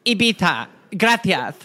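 "Ibiza" and "Gracias" with th sounds; lisp. Shown as a demonstration. Showing how Spaniards say it, lisped "Ibiza", "Gracias". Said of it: "that's what it's supposed to be".

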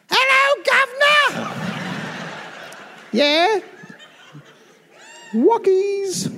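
very high squeaky British voice; mock Cockney. Pretending to be a British dog. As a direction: yip it like a little English dog.

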